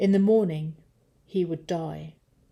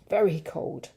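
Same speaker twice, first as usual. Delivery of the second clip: plain reading voice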